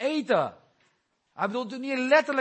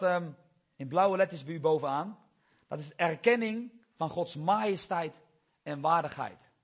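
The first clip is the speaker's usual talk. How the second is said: ordinary talk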